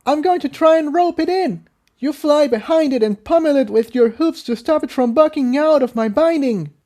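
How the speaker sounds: instructing Tiptoe mid-fight, urgent; Incendia's voice. A narrator's reading.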